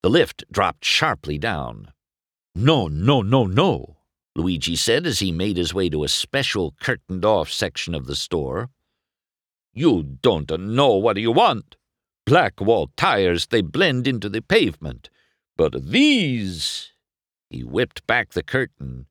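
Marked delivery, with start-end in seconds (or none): 2.6-4.0 high, slow Italian glee; excited Italian shopkeeper. Acted. Luigi delighted by his first customer, Italian accent. 9.7-16.9 excited Italian shopkeeper. Acted. Luigi delighted by his first customer, Italian accent.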